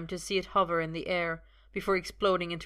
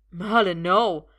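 loud frantic plea; desperate loud "Merlin, no!"; 9 dB louder, slower than usual. A narrator's reading. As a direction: cry out in panic to stop him. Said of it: "Arthur says frantically".

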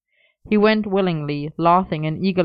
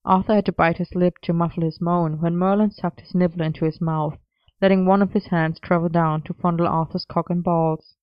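ordinary delivery, nothing marked

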